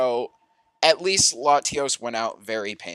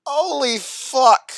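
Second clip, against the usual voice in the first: slow, high, loud stunned cry; horrified holy fuck; shocked exclamation; 10 semitones higher, 6 dB louder, much slower than usual. Real, not acted. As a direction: drag it out in disbelief. Shocked reaction to gore.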